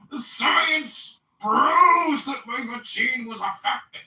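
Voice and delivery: high, hammed-up English accent, mocking. To the podcast host, mocking a man's vaccine defence in an English accent. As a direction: send up his posh English lecture in a fake English accent. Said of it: "mocking him in my English accent".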